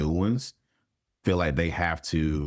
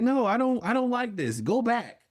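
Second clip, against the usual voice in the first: high-pitched, comic protest; alarmed refusal. Retold or imitated. Client begging the intern to drop clinical talk, alarmed protest. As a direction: imitate a client recoiling, pitched way up.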